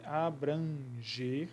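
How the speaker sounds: slow demo word. Shown as a demonstration. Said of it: "an aqui é um dígrafo".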